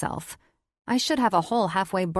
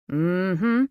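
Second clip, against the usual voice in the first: cooing; 5 dB louder than usual. Acted. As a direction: purr a pleased hum. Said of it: "he coos".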